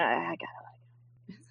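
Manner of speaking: whispered to herself. Real, not acted. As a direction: breathe it out like a private reminder. Muttering advice to herself.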